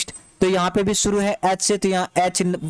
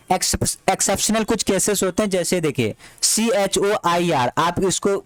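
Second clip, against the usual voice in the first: ordinary talk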